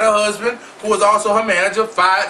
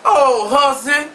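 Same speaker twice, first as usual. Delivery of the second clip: [name] impression; 4 semitones higher, slower than usual. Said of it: "in the words of [name]".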